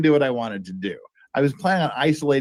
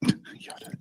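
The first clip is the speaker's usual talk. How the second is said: whisper; 10 dB quieter than usual. Real, not acted. Softly calling the dog.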